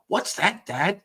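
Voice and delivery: put-on high, puzzled child asking Dad; kid's voice. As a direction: play the kid, pitched high and confused.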